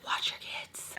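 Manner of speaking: urgent whisper; whispered warning. Real, not acted. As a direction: whisper it seriously. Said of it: "I mean it".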